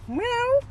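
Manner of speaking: very high, short meow; imitated cat meow. Man pretends to be a cat, high meow. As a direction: squeak out a fake cat call.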